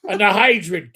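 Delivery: ordinary talk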